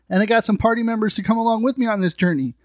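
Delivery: normal talking voice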